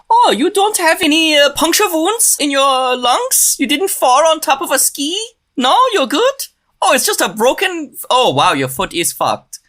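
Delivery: high-pitched, hammed-up Swiss German accent; Swiss German doctor accent. Emergency doctor checking a patient's injuries, hammed-up Swiss German accent. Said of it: "I don't know what accent this is".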